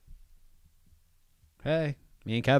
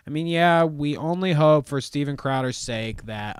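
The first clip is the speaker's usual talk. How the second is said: ordinary talk, nothing marked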